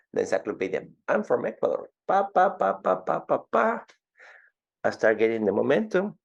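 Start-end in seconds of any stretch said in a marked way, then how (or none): 1.1-3.8 high, playful rattle of sales patter; self-mocking sales pitch. Salesman introducing himself from Ecuador, self-mocking. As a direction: make fun of your own accent in a sing-out spiel. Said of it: "make fun of my accent".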